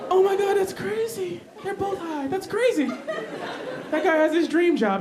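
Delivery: high, slow awed delight; amazed delight. Acted. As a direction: marvel at it. Marveling at high worker.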